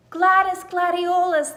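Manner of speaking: loud, high ringing name; trumpeting call. A narrator's reading. Trumpet vine announcing the new name, trumpeting. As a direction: blare it like a trumpet. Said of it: "the trumpet vine proclaimed".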